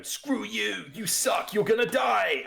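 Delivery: high, hammed-up battle cry; mock defiant shout. Acts players cutting off villain monologue.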